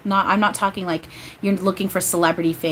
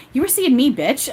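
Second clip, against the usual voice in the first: higher-pitched mock offence, half laughing; playfully offended; 6 semitones higher, 4 dB louder than usual. Medium voicing Eric to listeners, playfully offended. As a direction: act offended but let the giggle through. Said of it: "He's, like, playfully offended".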